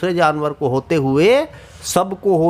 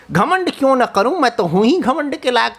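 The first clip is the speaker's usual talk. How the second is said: boastful; 6 semitones higher, faster than usual. Acted. Arrogant kite boasts.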